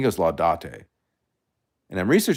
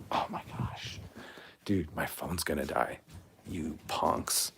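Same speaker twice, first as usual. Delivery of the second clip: whisper; 11 dB quieter, slower than usual. Real, not acted. Phone dying while streaming live.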